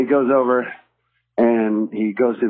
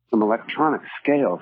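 ordinary delivery

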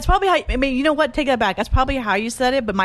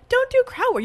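much higher, curt mock command; mocking his blunt order. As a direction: mimic him as a bossy scold. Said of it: "Nah, nah, nah, nah. You were like".

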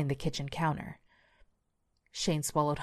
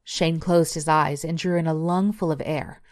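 plain reading voice

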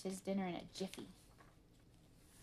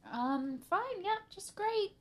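nervous, very high-pitched, loud reassurance; falsely cheerful; 12 semitones higher, 5 dB louder, slower than usual. Acted. Arthur hiding the crash from Mom, falsely cheerful. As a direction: cover up guilt too brightly.

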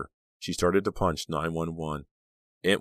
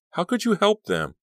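high, loud, incredulous reproach; appalled; 5 dB louder than usual. A narrator's reading. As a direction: accuse her in shocked disbelief. Girl appalled at aunt's betrayal.

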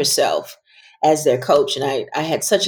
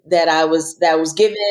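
ordinary delivery

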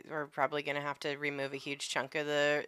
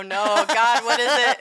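louder and higher, mock irritated; annoyed loud reply; 7 semitones higher, 12 dB louder than usual. Husband shouting back at his wife, mock exasperated.

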